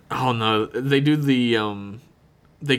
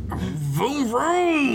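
engine vroom; 14 semitones higher than usual. Retold or imitated. Imitated engine noise.

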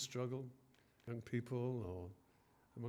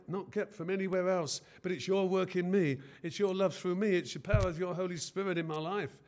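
louder, higher fervent praying; impassioned prayer; 5 semitones higher, 8 dB louder than usual. Acted. Preacher praying aloud for his congregation, fervent. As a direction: pour it out as a prayer. Prayer rising to full force.

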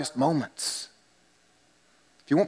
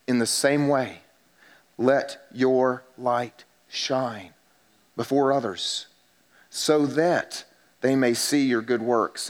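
scripture reading; 6 semitones lower than usual. Acted. Reads the scripture passage aloud.

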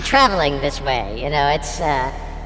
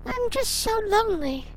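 Cindy voice; 9 semitones higher than usual. Acted. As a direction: play a lonely woman, pitched high.

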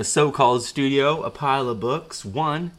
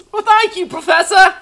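put-on pitched way up, eager young Harry; high student voice; 8 dB louder than usual. Harry greeting his professor in a high eager voice. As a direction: squeak out a keen pupil's greeting.

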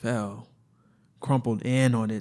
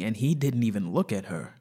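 ordinary delivery

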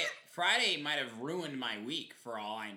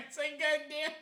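laughing through the words; giggling it out; 6 dB louder than usual. Real, not acted. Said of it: "echo with laughter".

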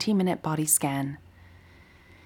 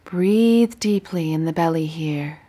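guided meditation. Acted. Teacher guiding listeners lying down through a body scan, meditative. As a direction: guide them gently through breath and body, unhurried.

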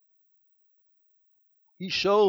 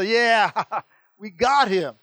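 gloating laugh; 5 semitones higher, 8 dB louder, slower than usual. Acted. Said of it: "laughing and maybe high-fiving".

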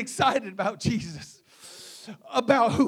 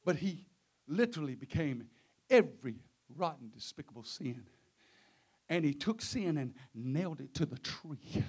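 very soft, low and halting; hushed, solemn preaching; 7 semitones lower, 7 dB quieter than usual. Real, not acted. Preacher pondering the sin laid on Christ, low and hushed. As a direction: let each phrase fall into a long silence.

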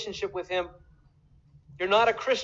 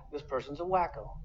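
muttered aside; 3 semitones lower, 7 dB quieter than usual. Retold or imitated. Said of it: "Tolerance says".

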